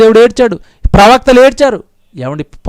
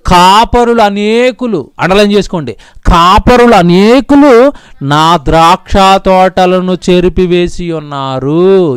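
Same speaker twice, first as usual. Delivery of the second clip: slow, deliberate verse reading; slow solemn reading from Jeremiah; slow scripture reading; slower than usual. Acted. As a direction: read each word of the verse with weight. Reads Jeremiah verse slowly.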